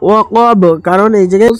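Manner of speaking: Quran recitation. Shown as a demonstration. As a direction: recite it slowly, stretching the vowels. Teacher recites verse lines. (ধীর সুরেলা তিলাওয়াত)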